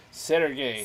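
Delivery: "Sergey" said with a Russian accent, rolled r. Shown as a demonstration. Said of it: "gotta say it with the Russian accent".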